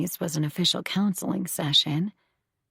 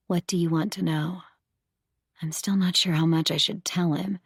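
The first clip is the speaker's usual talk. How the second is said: ordinary delivery, nothing marked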